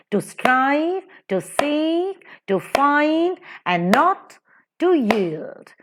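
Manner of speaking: rising iambs, "strive", "seek", "find" lifted high; chant-like beat on "to strive, to seek, to find", slow "and not to yield"; light "to", lifted stress on each following word; iambic stress. Shown as a demonstration. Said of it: "unstressed and stressed repetition".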